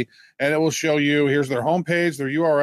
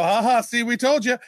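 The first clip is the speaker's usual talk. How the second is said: higher mocking gloat; sing-song told you so, pitched up; mock gloating doubters; 8 semitones higher than usual. Co-host mimicking sceptics gloating, higher mock voice. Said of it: "they go".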